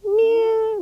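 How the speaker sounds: high, drawn-out cat meow. Shown as a demonstration. Teacher meowing like a cat for a child in cat-cow. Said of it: "Let me hear it".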